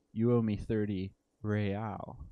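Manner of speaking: slow, lordly mock aristocratic accent rolling the R in real; a snooty mock aristocratic accent savouring real. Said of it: "with a mark aristocratic, or a mock aristocratic accent".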